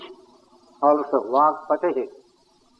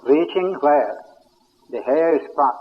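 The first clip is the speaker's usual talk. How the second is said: ordinary delivery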